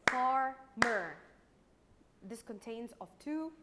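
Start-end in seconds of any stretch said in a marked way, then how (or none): 0.1-1.2 "Farmer" in two loud, separate beats; split into syllables. Shown as a demonstration. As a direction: clap out "Farmer" syllable by syllable, loudly. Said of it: "divide it into syllables".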